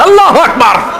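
high, loud call of Allahu Akbar; ringing takbir. Real, not acted. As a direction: rouse the crowd with a ringing cry. Preacher calls out takbir.

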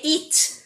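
"Eat" said with a long ee, not "at"; teacher showing how "Eat" sounds. Shown as a demonstration. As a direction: stretch the ee of "Eat". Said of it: "escreve at, você vai falar eat".

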